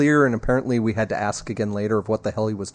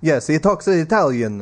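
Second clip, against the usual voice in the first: mock Italian accent; 3 semitones higher than usual. Mocks pope as stereotype Italian.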